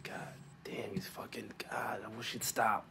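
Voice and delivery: hushed, breathless whisper to himself; whispered thought. Retold or imitated. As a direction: whisper it like you can't believe what you're hearing. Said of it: "I'm like".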